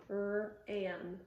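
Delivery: "R-am" split into R, pause, "am"; segmented word. Shown as a demonstration. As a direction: break "R-am" after the R, no W. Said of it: "segment it like this".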